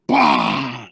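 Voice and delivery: a loud, low burst; imitated bang. Storyteller mimicking the tire blowing out, loud bang. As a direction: make the pop of a tire blowing.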